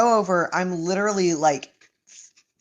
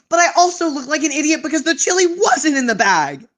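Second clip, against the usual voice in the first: higher and louder, exasperated; indignant rant; 6 semitones higher, 4 dB louder than usual. Real, not acted. Retelling the chili fiasco to listeners, indignant and louder. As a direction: vent the unfairness, voice rising. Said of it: "genuinely so angry".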